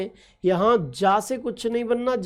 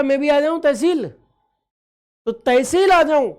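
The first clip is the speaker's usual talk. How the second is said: higher, louder, drawn-out question; younger brother asking; 4 semitones higher, 5 dB louder, slower than usual. Acted. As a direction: call it out slowly like an eager kid brother. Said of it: "छोटा भाई पूछ रहा".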